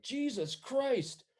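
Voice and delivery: high, slow, loud cry; pained outcry. Acted. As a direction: cry out like a man just hit in the back. Fallen guard cries out when hit.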